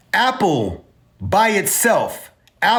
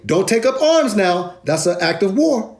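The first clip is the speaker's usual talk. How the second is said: ordinary talk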